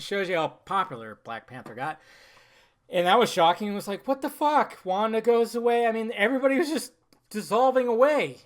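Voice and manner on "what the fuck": shocked reaction. Retold or imitated. Acts shocked reaction to film.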